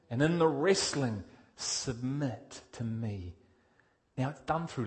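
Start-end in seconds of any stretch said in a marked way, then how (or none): 1.5-3.3 soft, slow, lower command; God's quiet call. Acted. God calling the believer to submit, soft and slow. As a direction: invite surrender calmly.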